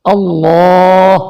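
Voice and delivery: اللہ with a full, heavy lam, drawn slowly; اللہ said slowly with a thick lam; heavy Allah. Shown as a demonstration. Teacher demonstrating the heavy lam of اللہ. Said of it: "یہ لفظ جو اللہ ہے".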